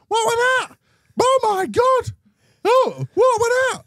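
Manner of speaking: very high, frantic mother's shriek; shrieking mum. Retold or imitated. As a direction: squeal it in panic. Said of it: "My mother".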